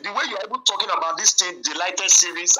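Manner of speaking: ordinary talk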